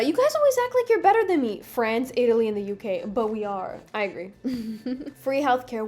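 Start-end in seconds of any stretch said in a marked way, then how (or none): 0.0-1.6 high, loud mock whine; exaggerated high-pitched complaint; complaining Canada. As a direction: play an aggrieved sibling, pitched way up. Voices Canada complaining to other countries.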